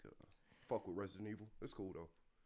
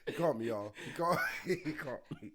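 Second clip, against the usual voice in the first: laughing. Real, not acted. Laughing at friend's excitement.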